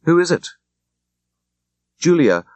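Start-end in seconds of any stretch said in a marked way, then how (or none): 0.0-0.5 anxious. A narrator's reading. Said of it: "asked Aunt Kate anxiously".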